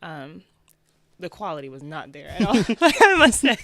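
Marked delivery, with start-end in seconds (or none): 1.2-3.6 loud, laughing self-mockery; laughing admission. Real, not acted. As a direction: break into a real laugh while admitting it. Laughs at own early work.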